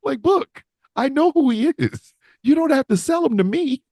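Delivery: high-pitched, pleading mock complaint; exasperated protest. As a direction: plead with him to stop overselling. Acted protest to overexcited commentator.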